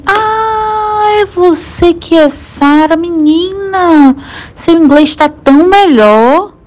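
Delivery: high, slow, drawn-out delighted surprise; light up as you recognise her; surprised recognition. Retold or imitated. Doctor recognising her patient, high delighted surprise. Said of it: "falou assim".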